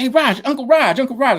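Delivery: high, eager call to Uncle Raj; excited greeting. Retold or imitated. Rapper calling out to his idol after the show, high and eager.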